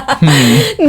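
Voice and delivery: high doubtful hum; a judging, pondering hmm; skeptical hmm. Retold or imitated. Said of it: "you're just like".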